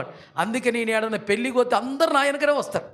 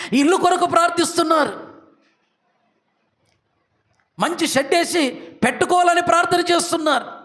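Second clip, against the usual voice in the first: higher, louder rising preacher's litany; preaching at full force; 9 semitones higher, 6 dB louder than usual. Acted. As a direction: build the list like a revival preacher. Preacher building up the list.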